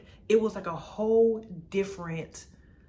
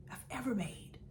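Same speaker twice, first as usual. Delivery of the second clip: much softer, breathy repeat of "I've ever made"; a soft whispered afterthought; whispered echo; 3 semitones higher, 9 dB quieter than usual. Real, not acted. Whispered echo of own words.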